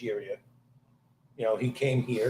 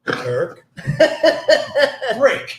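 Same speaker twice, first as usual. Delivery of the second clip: mock wife; 11 semitones higher, 11 dB louder than usual. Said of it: "my wife's like".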